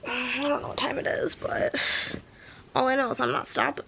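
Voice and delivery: whispered aside. Real, not acted. Confiding late-night aside.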